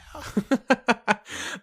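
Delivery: high-pitched burst of laughter; real laugh, pitched well up. Real, not acted. Laughs at own plug.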